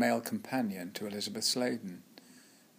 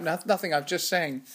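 flustered excuse; 6 semitones higher, 5 dB louder than usual. Retold or imitated. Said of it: "What are you laughing at".

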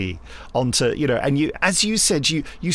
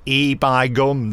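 slow, drawn-out northern "E by gum"; a light northern "E by gum", said slowly as a cue; northern accent; 4 dB louder, much slower than usual. Retold or imitated. Director cues the actor with a slow, lightly northern "E by gum". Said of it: "I just go".

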